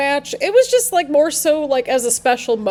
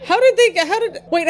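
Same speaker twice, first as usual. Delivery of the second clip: startled disbelief; 8 semitones higher, 3 dB louder than usual. Real, not acted. Becky escapes the pin.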